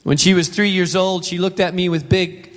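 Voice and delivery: normal talking voice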